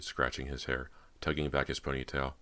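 plain reading voice

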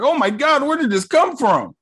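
high, startled mock panic; hammed-up bewildered hero; confused surprise. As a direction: act baffled at the gun in your hand. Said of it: "He like".

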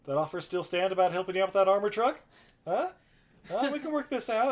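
mock desperate deal-making; nervous bargaining. [name] at gunpoint bargaining.

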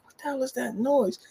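high, baffled acted question; puzzled retold question. Studio visitor wondering at a racket, mock baffled. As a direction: act out hearing a strange noise. Retelling his puzzled reaction in story.

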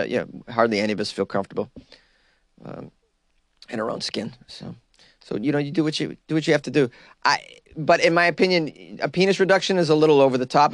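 usual speaking voice

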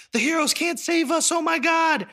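high, frantic crowd voice; panicked crowd. Retold or imitated. Stadium crowd panicking about the heroes, high and frantic. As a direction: shriek it like terrified onlookers.

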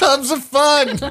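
high, drawn-out fairground holler; hammed-up carny pitch with laughter; carny call. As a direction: sing it out slowly like a carnival barker. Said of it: "basically that Carney guy".

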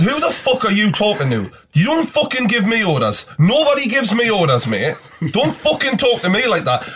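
high, forceful indignation; acted angry confrontation. Passenger refusing the driver's orders, acted anger.

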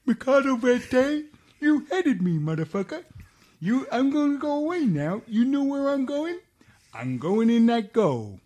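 high, odd voice of the ball mocking him; talking ball voice. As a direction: play the ball as a cheeky character. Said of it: "and the ball say".

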